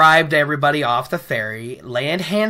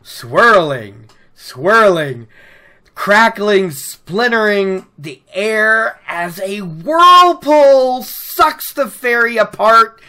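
dramatic storytelling; 4 semitones higher, slower than usual. Acted. Dramatic retelling of whirlpool.

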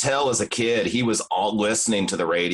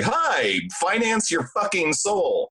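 cheery ad mascot; 7 semitones higher, slower than usual. Retold or imitated. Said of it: "it's like".

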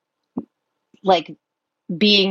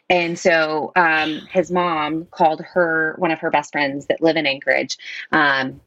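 usual speaking voice

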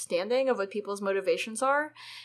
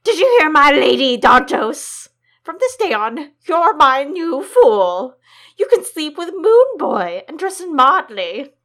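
loud royal proclamation; raised voice; 5 semitones higher, 12 dB louder than usual. Acted. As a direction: announce it to the whole court. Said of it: "He raised his voice".

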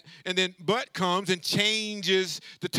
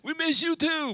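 high-pitched mock reply; congregation's expected reply; 7 semitones higher than usual. Preacher voicing what his congregation should have answered, in a high mock voice. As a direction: pitch it up as the crowd answering back. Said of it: "somebody's supposed to say".

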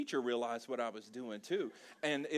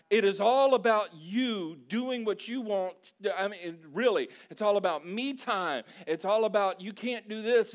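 full-force pulpit preaching, much louder; fired-up preaching; 6 semitones higher, 7 dB louder than usual. Acted. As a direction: preach it hard against the magazine. Preaches against the magazine at full force.

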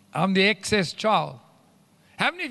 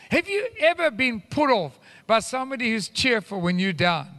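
usual speaking voice

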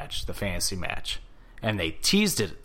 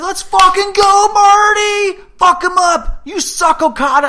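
fan shouting; 15 dB louder, slower than usual. Retold or imitated. Fan cheering Marty at the show.

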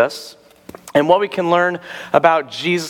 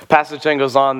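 ordinary talk, nothing marked

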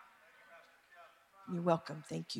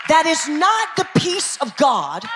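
loud, high preacher's cry, building; preaching at full force; 10 semitones higher, 8 dB louder than usual. Acted. Pastor rousing the congregation against compromise, loud and high. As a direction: shout it up to the rafters.

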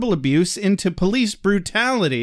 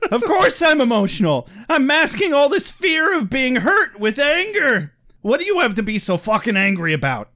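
hammed-up angry son; 6 semitones higher than usual. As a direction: rant at Dad in fake teen anger. Voiced son ranting at dad.

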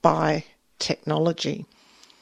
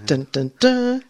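loud high dun dun dun; dramatic sting; 6 semitones higher, 5 dB louder than usual. Pretend. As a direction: sing a suspense sting. Mock dramatic sting before question.

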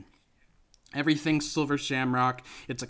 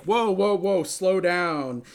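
louder, slowed-down smooth hero; cocky Tom Atkins; 4 semitones higher, 6 dB louder, slower than usual. Retold or imitated. Host voicing Tom Atkins slowing Ellie down, loud and smooth. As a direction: stretch out the whoa, coolly.

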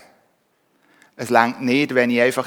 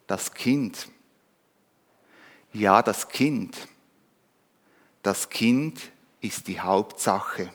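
softer, slower, head-hung admission; ashamed; 3 semitones lower, 5 dB quieter, slower than usual. Acted. Ashamed ox admitting to the donkey, soft and humbled. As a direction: quietly own up, humbled. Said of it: "senkte der Ochse beschämt den Kopf".

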